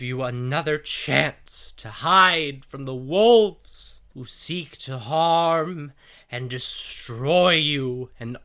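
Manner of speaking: loud, slow, grand preaching; cult leader sermon. Acted. Leader addresses kneeling followers, slow booming sermon. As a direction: proclaim it to the flock, stretching key words. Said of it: "And you hear".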